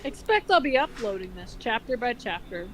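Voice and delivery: ordinary talk, nothing marked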